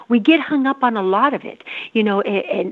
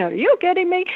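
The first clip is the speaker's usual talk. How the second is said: higher, mock disbelief; retold exasperation; 8 semitones higher than usual. Repeating it during the anger stage, mock exasperated. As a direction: toss it off in disbelief. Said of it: "I kept saying".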